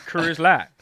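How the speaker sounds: thick Southern drawl, loud; Southern accent. Retold or imitated. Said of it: "really thick Southern accent".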